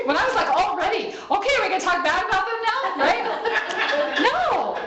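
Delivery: high laughing delivery. Real, not acted. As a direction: laugh through the words. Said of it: "laughing at herself".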